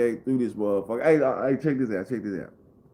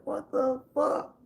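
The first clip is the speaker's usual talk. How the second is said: mock crying voice; 5 dB quieter, slower than usual. Imitating crying neighbor.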